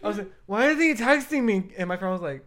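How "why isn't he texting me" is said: high, loud replay of being mad; exaggerated indignant demand; mock indignant. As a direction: act out an outraged complaint. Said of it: "Being, like, mad that he wasn't texting me".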